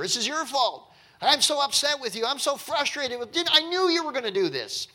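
high, petulant complaining voice; upset Jonah. Retold or imitated. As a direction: blame God like a sulking child. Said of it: "he's blaming God's goodness for his anger".

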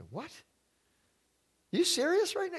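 stunned rhetorical question to the congregation; incredulous. Real, not acted. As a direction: pitch jumps way up in disbelief. Disbelief at the people's choice.

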